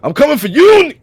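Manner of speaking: high, loud wrestler yell; wrestling promo. Retold or imitated. Hulk Hogan promo repeated.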